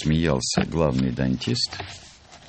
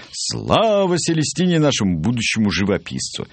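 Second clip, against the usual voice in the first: yelled cheer; 6 semitones higher than usual. A narrator's reading. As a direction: proclaim it to a whole crowd. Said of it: "Вдруг заорал главный дантист".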